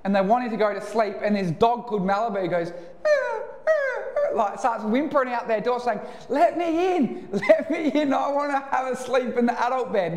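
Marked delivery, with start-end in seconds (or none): 3.0-4.4 very high imitated dog whine; high pleading canine whine; dog whimpering. 6.3-10.2 lightly voiced whining dog; pleading dog. Voicing the dog Malibu.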